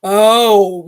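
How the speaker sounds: loud, deep elephant bellow; big deep voice. A narrator's reading. Elephant bitten on the leg, bellowing in a deep voice. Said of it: "in his big, deep voice".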